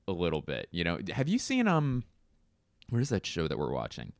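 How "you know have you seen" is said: ordinary talk, nothing marked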